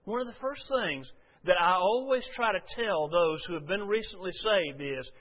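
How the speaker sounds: ordinary talk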